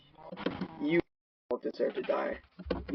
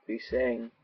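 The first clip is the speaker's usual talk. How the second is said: ordinary delivery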